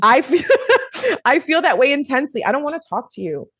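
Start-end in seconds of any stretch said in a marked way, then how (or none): 0.5-1.2 high squealing laugh. Real, not acted. Laughing at her own confession, high squealing laugh.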